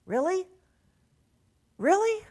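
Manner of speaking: sharply rising disbelieving really; incredulous. Real, not acted. Preacher questioning coastal people's readiness in disbelief. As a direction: challenge them with disbelief.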